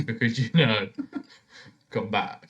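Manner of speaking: ordinary delivery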